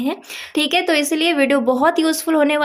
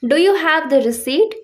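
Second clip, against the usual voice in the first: slow, clear example line; slow example sentence; 3 semitones higher, 3 dB louder, much slower than usual. Shown as a demonstration. Teacher reading the example to students, slow and clear. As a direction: read each word slowly for learners. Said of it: "example देखिए".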